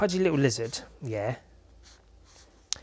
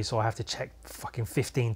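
normal talking voice

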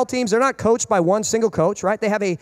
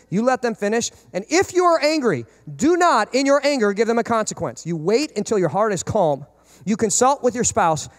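ordinary talk

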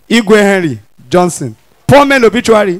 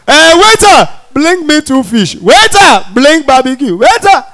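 demanding shout; 5 semitones higher than usual. Retold or imitated. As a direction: holler for the waiter over the noise. Women shouting for waiter.

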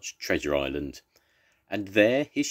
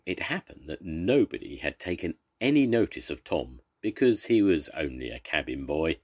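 plain reading voice

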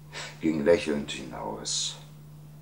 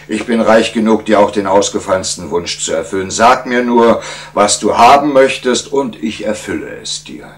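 louder, higher royal proclamation; a king grandly offering a reward; gracious king; 5 semitones higher, 10 dB louder than usual. Acted. King rewarding the inventor, grand and loud.

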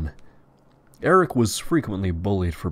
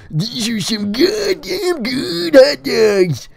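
very high, loud, slow shout with full mouth; screams out; 8 dB louder, much slower than usual. Acted. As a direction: bellow it across the church. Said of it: "he screams out".

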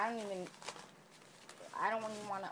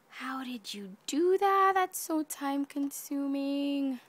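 amazed whisper-shout; 4 semitones higher, 7 dB louder than usual. Real, not acted. Amazed at friend's craft.

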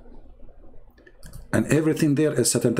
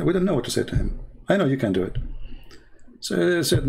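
usual speaking voice

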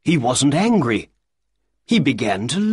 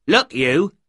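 raised, higher angry shout at the little man; 5 semitones higher than usual. Acted. Shopkeeper shouting at a pestering little man, angry. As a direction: bark it at him in anger. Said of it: "Breckinridge shouted".

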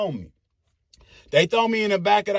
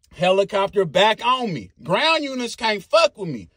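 usual speaking voice

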